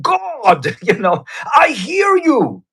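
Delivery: put-on high, exclaiming Nigerian accent with a laugh breaking through. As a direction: imitate the frustrated worship leader crying out to God in a Nigerian accent. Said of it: "he goes in his Nigerian accent".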